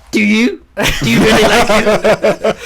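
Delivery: a light, high-pitched comic impression; a hint of a stand-up comic's incredulous voice; playful mock comedian; mock Michael McIntyre. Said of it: "Do the head".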